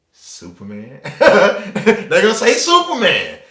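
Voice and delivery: laughing through the punchline, slow. Real, not acted. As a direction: crack up as you say it. Laughing at his own joke.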